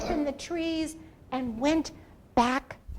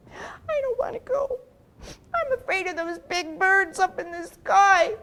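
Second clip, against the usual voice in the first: high, trembling voice of a frightened turtle; scared Turtle; 7 semitones higher, 6 dB louder than usual. Acted. Timid Turtle refusing to go, high and trembling. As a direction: whimper it out like a scared little turtle.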